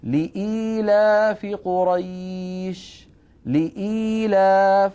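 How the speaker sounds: لإيلاف with the ii stretched slow and chanted; Quran recitation. Shown as a demonstration. Teacher chanting Surat Quraysh to show the madd. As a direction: recite لإيلاف holding the long ii. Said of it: "فيها ياء ساكنة قبلها همزة".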